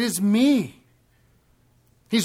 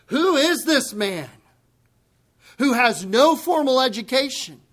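shocked Pharisees; 6 semitones higher, 5 dB louder than usual. Retold or imitated. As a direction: gasp out the question like a scandalized scholar.

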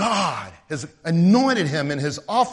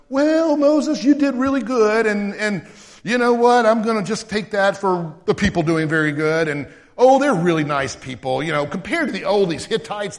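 much higher, louder, fawning praise voice; louder, higher, chatty mock God; mock flattering God; 5 semitones higher, 7 dB louder than usual. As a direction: parody a people-pleasing God gushing compliments. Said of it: "That's not what he said".

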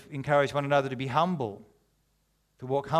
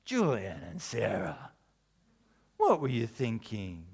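1 mock disappointed; 5 semitones lower, 4 dB quieter than usual. Preacher scolding the story couple.

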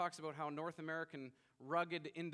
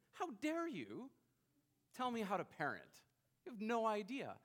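pitched high, offended, louder; indignant outburst; 7 semitones higher, 4 dB louder than usual. Retold or imitated. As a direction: bristle at a stranger's parenting advice. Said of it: "in my, in my spirit, I said".

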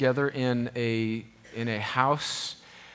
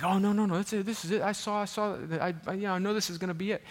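high-pitched, hurried self-quote in protest; mock insistent denial; 8 semitones higher, faster than usual. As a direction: brush off the doubt, pitched way up. Insisting to doubting wife.

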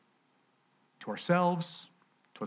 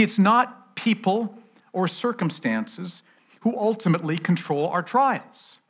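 normal talking voice

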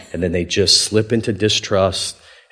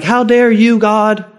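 high, loud wailing protest; hammed-up tearful outrage, pitched way up; mock crying; 10 semitones higher, 5 dB louder than usual. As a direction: imitate distraught people complaining to God. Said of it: "And they're crying".

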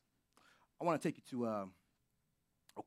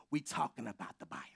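whisper; 7 semitones lower, 5 dB quieter, faster than usual. Real, not acted. Sudden drop to a whisper.

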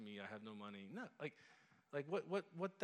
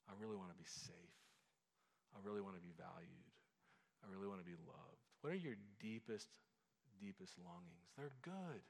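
soft prayer; 4 semitones lower, 5 dB quieter than usual. Shown as a demonstration. Modelling a quiet personal prayer.

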